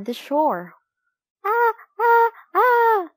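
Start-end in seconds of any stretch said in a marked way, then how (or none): 1.5-3.1 very high, loud seagull calls; laughing gull cry. A narrator's reading. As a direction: screech out three gull cries. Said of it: "cried the laughing gulls".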